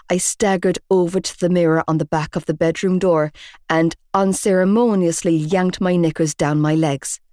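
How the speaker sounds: ordinary delivery, nothing marked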